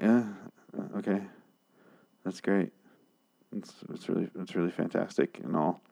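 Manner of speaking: quiet, halting faint praise; unimpressed. Retold or imitated. Viewer reacting to a speech he didn't care about, unimpressed. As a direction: trail through the praise softly, unconvinced. Recounts his underwhelmed reaction.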